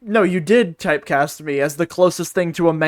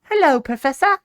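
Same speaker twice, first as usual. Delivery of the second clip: put-on high boyish voice, much higher; Luke Triton; 11 semitones higher, 4 dB louder than usual. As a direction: do a young boy apprentice, bright. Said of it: "Most mask voice I can do is Luke Triton".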